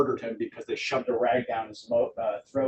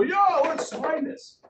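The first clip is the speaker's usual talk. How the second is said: much higher, slow, gushing delight; overexcited seller; slower than usual. Retold or imitated. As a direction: pitch it way up and stretch it out, giddy. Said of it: "they might get so excited".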